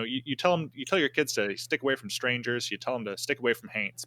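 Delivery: normal talking voice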